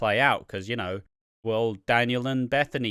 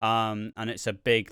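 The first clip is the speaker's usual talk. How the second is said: ordinary talk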